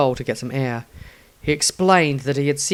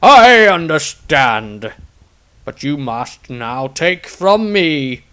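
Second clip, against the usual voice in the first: put-on higher, louder, slow solemn old voice; dying master's letter; 4 semitones higher, 7 dB louder than usual. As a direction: read it as a mentor's farewell. Magnamus's final written message.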